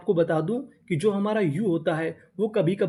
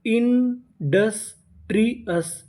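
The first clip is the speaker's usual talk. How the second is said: Industrious slowly broken into separate syllables; 3 semitones higher, much slower than usual. Shown as a demonstration. Teacher builds a new English word, industrious slowly in pieces. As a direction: draw out each part of industrious, pausing between. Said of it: "तो meaning क्या बनी?".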